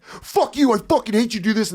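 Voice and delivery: high-pitched mock rant; frustrated outburst. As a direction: act out exasperation, pitch shooting up. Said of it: "now everyone's so frustrated that they're like".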